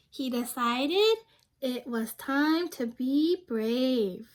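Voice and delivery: lively picture-book narration for a class; reading to children. A narrator's reading. Teacher reading a picture book aloud to her class. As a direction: read it like story time for young kids.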